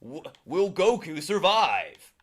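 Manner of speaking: slow, dramatic narrator cliffhanger; anime narrator voice. Retold or imitated. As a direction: build suspense like a TV announcer. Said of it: "that narrator was so good".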